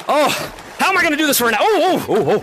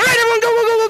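excited call; 11 semitones higher, 3 dB louder, faster than usual. Real, not acted. Starting the tag round, calling out.

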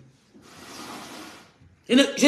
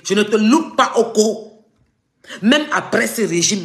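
heated threat; 4 semitones higher, 6 dB louder than usual. Real, not acted.

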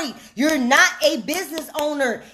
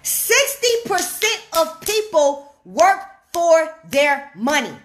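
"Sixty percent of people work for their money" slowed, word by word; emphatic repetition. Shown as a demonstration. Coach repeats a statistic for followers, slow and deliberate.

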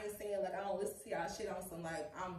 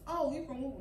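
mock confused asker; 6 semitones higher, 5 dB louder than usual. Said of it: "So when you tell people".

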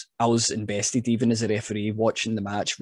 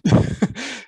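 a burst of high-pitched laughter; 12 semitones higher, 4 dB louder than usual. Real, not acted. Referee laughing at tripping on the rope, high laugh. Laughing at own ring mishap.

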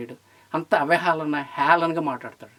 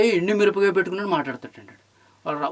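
ordinary talk, nothing marked

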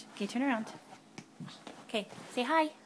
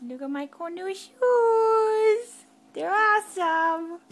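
higher, louder, slow and proud of the new shoes; proud toddler voice; 5 semitones higher, 6 dB louder, much slower than usual. Pretend. Mom voicing toddler showing shoes.

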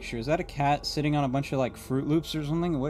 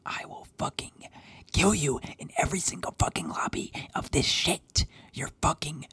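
menacing whisper. Acted. Threatening the wiped squad, menacing whisper. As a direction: whisper the threat close to the mic.

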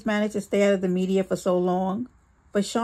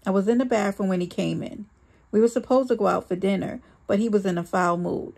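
ordinary delivery

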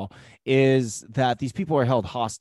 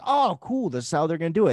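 excited; 5 semitones higher, 4 dB louder than usual. Retold or imitated. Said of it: "I was like".

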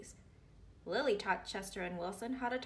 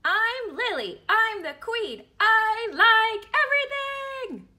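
put-on squeaky, slow little-girl voice, much higher and louder; high Lily voice; 12 semitones higher, 14 dB louder, slower than usual. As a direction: play bold little Lily announcing herself. Lily voiced in read story.

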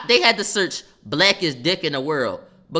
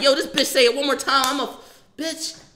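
heated; 10 semitones higher than usual. Real, not acted. Still heated at heckler.

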